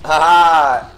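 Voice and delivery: loud, drawn-out gloating "haha"; loud taunting cackle; triumphant laugh. Real, not acted. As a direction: crow over a beaten enemy. Gloating over beaten game enemy.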